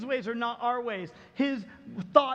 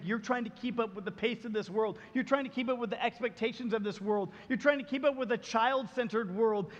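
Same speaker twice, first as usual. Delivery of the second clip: normal talking voice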